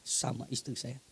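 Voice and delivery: normal talking voice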